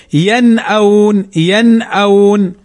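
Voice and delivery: ينأون with the n pronounced in full before hamza; clear izhar. Shown as a demonstration. Teacher showing how we say it, distinct n in ينأون. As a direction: say ينأون as "yan-awn", neither merged nor hidden. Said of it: "وإنما نقول".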